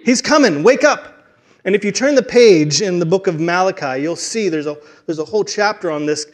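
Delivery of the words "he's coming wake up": higher, louder urgent wake-up call. Acted. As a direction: rouse sleepers with an urgent shout. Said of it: "messenger that's gonna wake you up".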